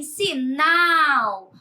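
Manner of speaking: "sinal" with NAL long and loud; stress on NAL. Shown as a demonstration. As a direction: slowly push the last syllable of "sinal". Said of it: "pronunciado com mais força". ("sinal" alongado, com força no NAL)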